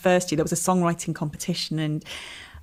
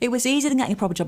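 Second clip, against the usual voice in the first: quick eager echo; 6 semitones higher, much faster than usual. Real, not acted. Guest repeats it, quick and high.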